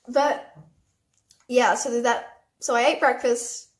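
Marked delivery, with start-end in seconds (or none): none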